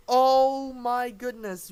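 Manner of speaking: loud, slow, stretched exclamation; amazed. Real, not acted. Commentator reeling from the decisive game, amazed. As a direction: draw it out in disbelief.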